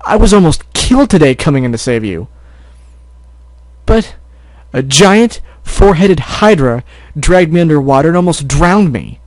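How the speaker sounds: angry shouting. Acted. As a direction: shout it, hurt and furious. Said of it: "couldn't stop himself from shouting".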